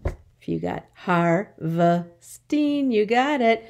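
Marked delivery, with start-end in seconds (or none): 0.9-2.9 "harvesting" drawn out slowly in three parts; syllable by syllable. Shown as a demonstration. Teacher checking the class's answer, "harvesting" slowly split up. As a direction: sound out "harvesting" slowly, one syllable at a time. Syllable lesson, word broken up.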